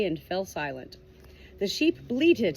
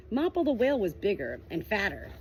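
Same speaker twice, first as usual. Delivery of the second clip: plain reading voice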